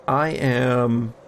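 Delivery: ordinary talk, nothing marked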